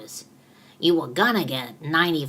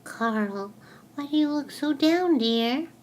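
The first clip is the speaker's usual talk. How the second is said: lifted, concerned asking; caring voice; 11 semitones higher than usual. Acted. To a glum Carl, a raised concerned question. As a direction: coax a sad friend to talk.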